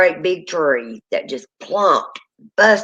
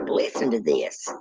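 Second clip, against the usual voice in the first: hushed; 10 semitones lower, 6 dB quieter, slower than usual. Retold or imitated. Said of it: "and I'm like".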